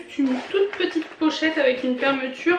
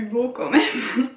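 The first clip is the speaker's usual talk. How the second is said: laughing; much slower than usual. Real, not acted. Laughing while finishing sentence.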